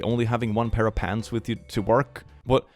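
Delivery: ordinary talk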